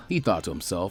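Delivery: usual speaking voice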